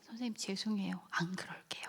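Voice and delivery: very soft, near-whispered apology; hushed, sheepish pupil; contrite child, imitated. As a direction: apologize meekly to the teacher.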